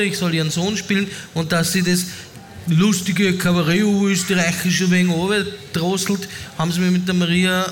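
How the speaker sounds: ordinary talk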